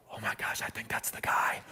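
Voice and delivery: hushed whisper to his wife. Retold or imitated. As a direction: whisper so the man won't hear. Freaked out at the restaurant.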